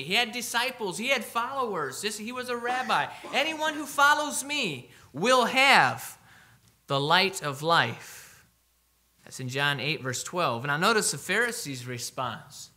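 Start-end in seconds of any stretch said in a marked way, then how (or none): 3.3-8.4 slow, climbing proclamation that settles at the end; fervent preaching. Acted. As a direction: stretch it out like a promise. Preacher voicing Jesus' promise.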